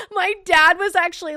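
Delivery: laughing through the words. Real, not acted. Confessing to chat about her dad, while laughing. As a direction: giggle out the confession.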